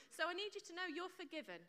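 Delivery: ordinary talk, nothing marked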